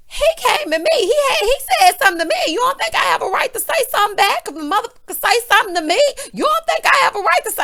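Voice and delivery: high, loud, fast hoarse rant; hammed-up screeching impression of a woman losing her mind; Heavenly losing it. Hoarse housewife flying off at her friend, mock hysterical shouting. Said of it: "Heavenly gets to losing her mind. And you know she always sound like she's so hoarse".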